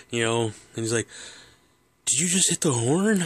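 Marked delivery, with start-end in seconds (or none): 2.1-3.2 higher, louder startled question; a dad who just jumped out of his skin; raised, disbelieving dad voice. Retold or imitated. Startled dad confronts son.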